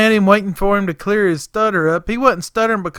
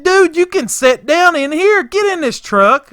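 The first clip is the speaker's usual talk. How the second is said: high, louder exasperated order; a little curt; 10 semitones higher, 6 dB louder than usual. Retold or imitated. Boss tells helper to get in the truck, curt. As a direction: order him in, fed up. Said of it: "got a little curt with him".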